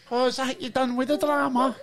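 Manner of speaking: mock Glasgow accent. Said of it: "can you do it again?".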